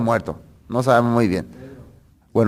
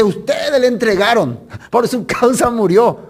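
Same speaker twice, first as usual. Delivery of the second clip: raised pitch, acted accusation; accusing preacher; 7 semitones higher than usual. Acts out Peter accusing the crowd. (acusación predicada, con voz aguda)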